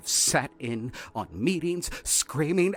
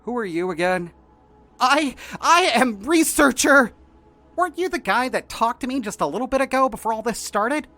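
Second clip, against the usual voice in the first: higher, louder nervous researcher voice; shrill researcher; 12 semitones higher, 12 dB louder than usual. Acted. Researcher loudly declaring duty to the two escapees. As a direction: puff up and declare your duty.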